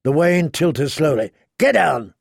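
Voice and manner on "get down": sharp barked order with a steep fall; roared command. A narrator's reading. Clegane ordering Arya off the wagon, restrained roar. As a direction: roar it as an urgent order, held in. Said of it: "Clegane roared at her".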